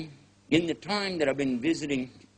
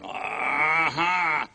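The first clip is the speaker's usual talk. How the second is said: mock gotcha. Catching newcomer's shoe mistake.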